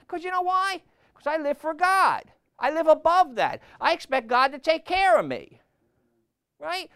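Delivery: loud, high, driving sermon voice; preaching at full force. Acted. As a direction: hammer it home from the pulpit.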